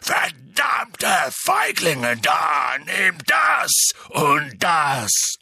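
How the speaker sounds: slow, loud bellow with each blow; roaring. A narrator's reading. Hagrid fighting off his attackers, roaring. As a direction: roar while landing punches. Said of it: "brüllte Hagrid".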